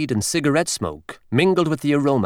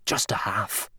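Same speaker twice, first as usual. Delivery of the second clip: unvoiced whisper, much softer; mouthed silently; 3 semitones lower, 8 dB quieter than usual. A narrator's reading. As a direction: breathe it soundlessly behind his back. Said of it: "mouthing silently".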